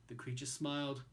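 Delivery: ordinary delivery, nothing marked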